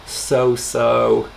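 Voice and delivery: slow, drawn-out "Hmm, so-so"; so-so, meh; mock noncommittal. Shown as a demonstration. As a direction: shrug it off lazily. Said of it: "like we would say in English".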